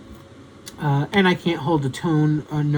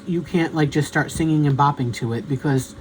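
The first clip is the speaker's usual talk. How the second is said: ordinary talk, nothing marked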